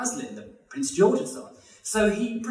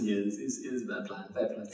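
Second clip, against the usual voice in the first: low, soft, muttered admission; sheepish husband agreeing; 7 semitones lower, 9 dB quieter than usual. Retold or imitated. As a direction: give in quietly, voice dropped. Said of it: "He says".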